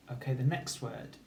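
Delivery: normal talking voice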